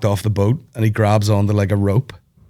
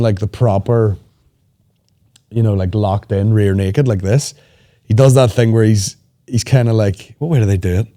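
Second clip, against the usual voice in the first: usual speaking voice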